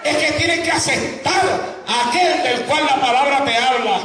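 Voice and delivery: high-pitched, fervent pulpit proclamation; full-force preaching. Acted. Preacher urges congregation to accept Christ, full-force preaching. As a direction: cry the word out with long, stretched key phrases.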